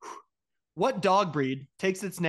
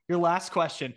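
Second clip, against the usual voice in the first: ordinary talk, nothing marked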